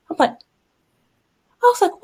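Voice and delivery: usual speaking voice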